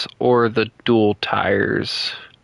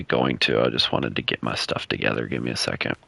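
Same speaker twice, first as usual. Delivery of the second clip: low mutter; 7 dB quieter than usual. Real, not acted. Voice drops low and quiet.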